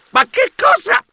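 sudden high squeak of surprise; startled outburst. Acted. Mouse startled by buzzing phone, high squeak. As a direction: jump at the flood of notifications.

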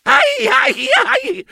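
high, sharp cries of pain; pained yelps. Acted. Burnt paw from falling crepe, high yelps.

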